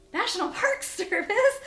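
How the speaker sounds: high, loud question breaking into laughter; incredulous echo. Retold or imitated. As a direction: repeat it in laughing disbelief. Her echo of the announcement, laughing.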